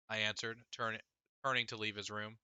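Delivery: usual speaking voice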